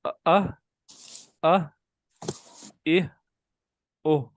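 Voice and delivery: اہ with a glottal catch then breathy ha; teacher showing hamza before ha; hamza-ha demo. Shown as a demonstration. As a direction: model the catch-and-breath syllables. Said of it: "اور آگے کیا ہے".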